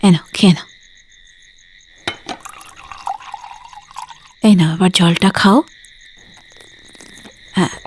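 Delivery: tender coaxing. Acted. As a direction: ease him back to sleep, hushed and tender. Daughter gives father medicine at night.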